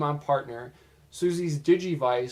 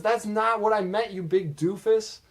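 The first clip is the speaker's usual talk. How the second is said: retold scolding; 7 semitones higher than usual. Retold scolding after the yell.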